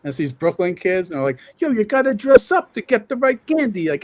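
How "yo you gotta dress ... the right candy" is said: put-on high, tough New York kid voice in the Sopranos style. Man quoting Halloween kids from the eighties in a Sopranos accent. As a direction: imitate a tough little Brooklyn kid. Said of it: "these kids are talking like Sopranos".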